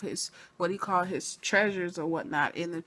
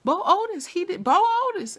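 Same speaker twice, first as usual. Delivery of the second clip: much higher, louder excited yell; 12 semitones higher, 6 dB louder than usual. Retold or imitated. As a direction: call out the culprit at the screen. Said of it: "I'm on the couch yelling".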